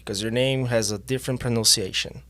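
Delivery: ordinary talk, nothing marked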